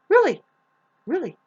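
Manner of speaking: shocked, high-pitched Really; incredulous. Real, not acted. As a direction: disbelief that bursts out. Incredulous outburst.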